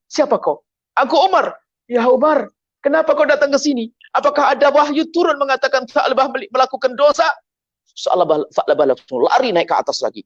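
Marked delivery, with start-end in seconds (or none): 0.1-0.7 high-pitched startled question. Acted. The boy is found by Umar, startled. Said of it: "Kaget dia". 1.0-1.5 calling out. Acted. Umar announces himself to startled boy. 1.9-7.3 alarmed. Acted. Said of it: "Kaget dia".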